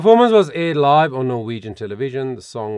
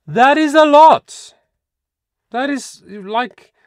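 amazed; 13 semitones higher, 11 dB louder, slower than usual. Real, not acted. Amazed at view count.

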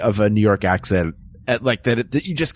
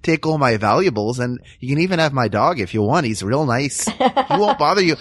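meek, over-obliging Midwesterner; mock Midwest accent, eager to please and conflict-shy. Pushover Midwesterner welcoming a robber, meek friendly Midwest accent. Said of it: "that accent is like George McFly before he punches Biff".

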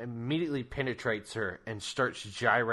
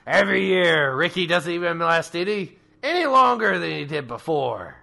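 Krampus gloating; 5 semitones higher, 6 dB louder than usual. Acted. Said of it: "he's like".

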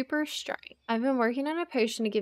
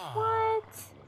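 incredulous what; 11 semitones higher than usual. Real, not acted. Shocked by trade offer.

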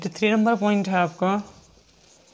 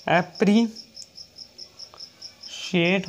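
very slow, each syllable stretched; teacher dictating an example word; slow dictation; much slower than usual. Shown as a demonstration. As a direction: dictate it slowly for notes. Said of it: "teacher dictating example word".

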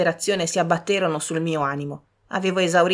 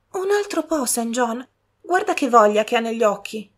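higher voice coaxing brother; higher sister pleading; 6 semitones higher than usual. Acted. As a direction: ask for a little more.

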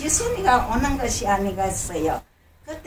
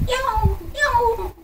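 high, playful cat meow; 12 semitones higher than usual. Acted. Cat meows again.

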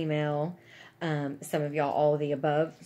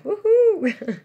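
high, loud gleeful whoop over a card; excited woohoo; 13 dB louder than usual. Retold or imitated. Cheering at getting a card, loud excited whoop. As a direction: cheer like a kid getting mail. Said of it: "I'm like".

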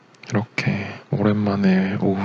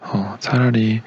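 ordinary talk

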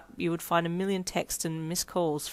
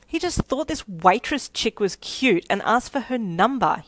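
raised-voice indignant huff; huffy; 4 semitones higher, 6 dB louder than usual. Acted. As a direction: huff it out, louder and higher. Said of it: "you huffed".

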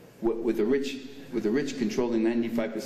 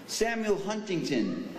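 ordinary talk, nothing marked

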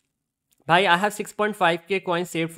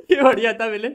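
laughing; 4 semitones higher, 5 dB louder, much faster than usual. Real, not acted. Laughing at a funny moment in play.

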